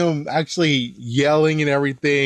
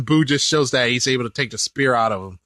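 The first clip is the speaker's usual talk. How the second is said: normal talking voice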